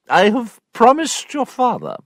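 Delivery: high, stern aunt voice; a pressing aunt, pitched high; prim aunt. Acted. Aunt pressing Sophia to marry, high stern voice.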